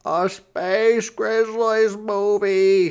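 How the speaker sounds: put-on high, loud and slow, thrilled little boy; excited cry. A narrator's reading. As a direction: cry it out, bursting with joy. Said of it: "he cried".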